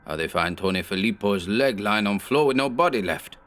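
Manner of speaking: a hint of a frightened heavy's accent, rising; lightly voiced nervous thug pleading; mild fearful broken-English plea; scared henchman in broken English.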